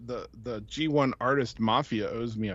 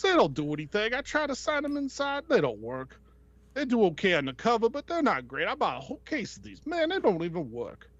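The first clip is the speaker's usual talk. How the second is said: high Barbarino-style wise-guy voice; much higher New York voice; artist's voice; 7 semitones higher than usual. Retold or imitated. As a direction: ask it like a Brooklyn tough guy. Voicing the artist.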